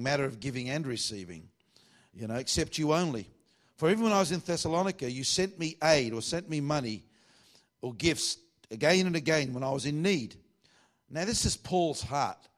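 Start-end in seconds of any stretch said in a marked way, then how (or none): 2.4-10.4 raised, loud pulpit reading; scripture reading. Acted. Preacher reading Paul's thanks to the congregation, high projected scripture reading. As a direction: proclaim the verse with lifted pitch.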